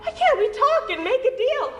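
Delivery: high, pleading little pig voice; crying piglet. Acted. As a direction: squeak it out like a begging piglet. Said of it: "He cried".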